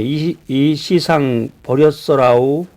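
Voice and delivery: slow, drawn-out Jeolla dialect; 느릿느릿한 전라도 사투리. Retold or imitated. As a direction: stretch it out like a Jeolla elder. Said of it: "전라도 사람들은".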